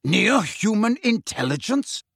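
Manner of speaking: slow, high, offended question; incredulous echo. A narrator's reading. Magorian repeating Umbridge's insult, incredulous. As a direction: repeat the phrase slowly in disbelief.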